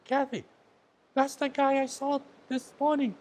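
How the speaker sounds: high, loud excited exclamation to his wife; excited telling. Retold or imitated. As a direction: blurt it out to your wife, amazed.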